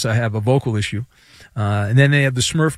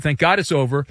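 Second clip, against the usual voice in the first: ordinary delivery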